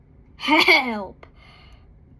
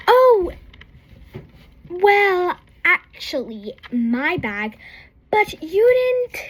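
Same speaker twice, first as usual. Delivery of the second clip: put-on flustered kid; 6 semitones higher, 5 dB louder, slower than usual. Girl stammering, caught out.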